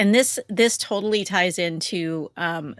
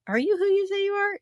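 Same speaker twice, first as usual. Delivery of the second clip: suspicious wonder; 13 semitones higher, faster than usual. Retold or imitated. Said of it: "And I'm like".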